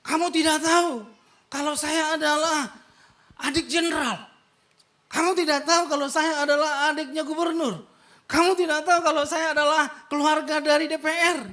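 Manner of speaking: loud, high boasting of connections; a mock pompous bully name-dropping; arrogant rich man. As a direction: rich driver bragging about his powerful relatives. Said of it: "Banyak orang ... sombongnya".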